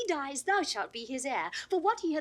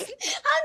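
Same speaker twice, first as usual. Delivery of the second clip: bright high laugh; 7 dB louder than usual. Acted. Laughing as the fool enters.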